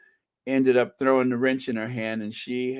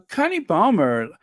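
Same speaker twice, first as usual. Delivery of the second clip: normal talking voice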